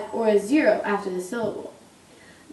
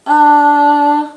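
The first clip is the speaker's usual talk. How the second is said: uh held high and level; first tone; 4 semitones higher, 10 dB louder than usual. Shown as a demonstration. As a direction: hold uh high and flat like a monotone. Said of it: "high and level".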